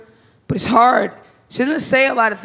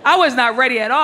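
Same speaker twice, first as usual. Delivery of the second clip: ordinary talk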